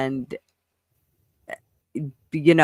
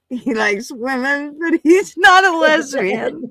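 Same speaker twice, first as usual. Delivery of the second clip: laughing shout; 9 semitones higher, slower than usual. Real, not acted. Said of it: "that was funny as hell".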